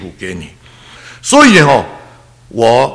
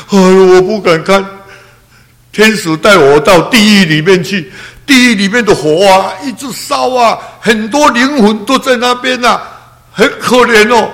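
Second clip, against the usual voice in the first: higher and louder, retelling her horror; mother's frightened account; 9 semitones higher, 5 dB louder than usual. Retold or imitated. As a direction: voice the mother shaken by hell, half-sung. Mother recounts seeing hell. (母亲惊恐地讲述地狱，声音高而响)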